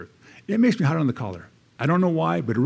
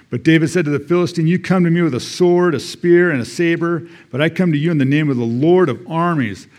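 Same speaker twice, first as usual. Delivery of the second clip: fervent scripture reading; 3 semitones higher than usual. Acted. Preacher reading David's challenge to the congregation, fervent and proclaiming. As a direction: proclaim it like a battle cry from the pulpit. Reads the battle speeches aloud.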